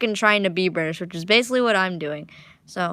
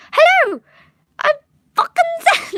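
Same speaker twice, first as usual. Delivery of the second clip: squealed way higher and louder in a botched fake British accent; a cartoon Zanzibar bursting in with a badly faked British accent, much higher; weird fake accent; 6 dB louder than usual. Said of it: "like, weird accent".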